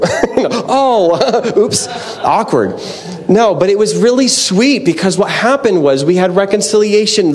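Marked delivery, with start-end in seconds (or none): 0.0-2.8 laughing. Retold or imitated. Laughs at imagined awkward call.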